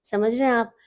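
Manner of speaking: normal talking voice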